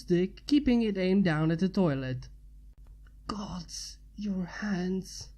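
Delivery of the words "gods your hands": soft, voiceless mumbled whisper; breathless whisper. Acted. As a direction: breathe the words, barely voiced. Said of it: "he mumbled".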